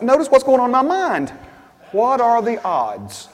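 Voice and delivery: loud, emphatic pulpit voice; preaching at full volume; full-force preaching. Acted. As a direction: drive the point home to the church. Preaching about doubting thoughts.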